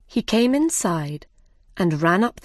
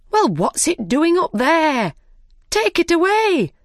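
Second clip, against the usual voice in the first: higher, louder cross rabbit, rising to a high snap; irritated rabbit ordering the arm removed; angrily; 9 semitones higher, 3 dB louder than usual. A narrator's reading. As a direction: scold the servant, climbing higher and louder. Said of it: "said the rabbit angrily".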